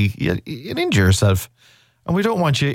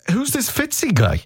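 incredulous; 12 semitones higher than usual. Real, not acted. Disbelief at unknown contestant.

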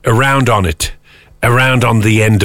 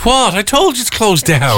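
exasperated, high-pitched outburst; shouting; 10 semitones higher than usual. Real, not acted. Caller gets a shop already ruled out, shouting. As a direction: blurt it out in high-pitched disbelief. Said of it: "Sorry for shouting".

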